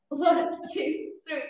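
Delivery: ordinary talk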